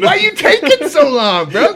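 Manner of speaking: calling out. Retold or imitated. High on heroin, calling to the guard, loud impatient call. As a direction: shout down the tier, impatient.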